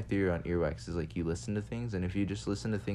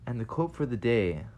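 ordinary talk, nothing marked

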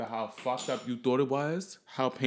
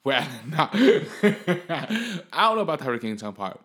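higher, louder, laughing through the words; 5 semitones higher, 6 dB louder than usual. Real, not acted. Taking back his own exaggeration, laughing through it. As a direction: laugh while walking back the joke.

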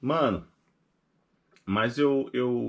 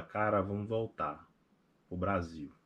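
quiet; 5 semitones lower, 8 dB quieter, slower than usual. Retold or imitated. Sad night talk with wife.

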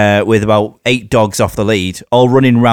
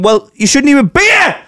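high indignant rant building to a shriek; hammed-up outrage at trespassers; mock angry outburst; 3 dB louder than usual. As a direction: build to a comic high-pitched yell. Imagined outburst at dog walkers.

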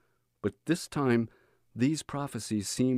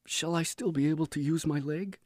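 anxious question; 6 semitones higher, 3 dB quieter than usual. A narrator's reading. Frightened patient asks about leg.

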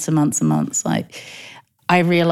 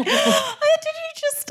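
much higher, slow, breathless disbelief; stunned disbelief; 3 dB quieter, slower than usual. Retold or imitated. Mother recalling her shock at a relative, high stunned voice. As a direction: gasp out the words in shock. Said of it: "And I was just like".

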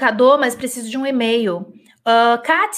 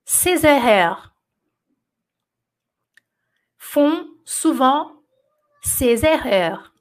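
slow, deliberate dictation with long pauses; dictation reading; 2 semitones lower, much slower than usual. Acted. Teacher dictating a French sentence to her students, slow and deliberate. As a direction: read each chunk slowly so students can write.

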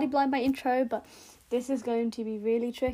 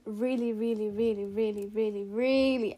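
each "really" stretched and leaned on, slightly lower; drawn-out emphasis; 3 semitones lower than usual. Real, not acted. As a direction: press on every "really", slowing down. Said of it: "when I emphasize on the word really".

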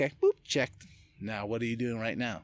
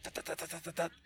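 soft, higher babbling da da da; mock mental chatter; 6 semitones higher, 10 dB quieter, faster than usual. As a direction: imitate a restless mind. Imitating racing mind.

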